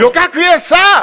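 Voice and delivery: loud high prompt to the audience; urging the crowd. Real, not acted. Storyteller eggs the audience on to shout the reply, loud and high.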